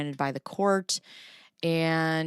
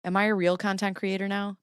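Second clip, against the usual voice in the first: ordinary talk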